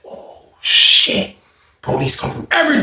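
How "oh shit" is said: whispered shock. Retold or imitated. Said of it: "I said".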